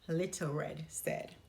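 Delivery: usual speaking voice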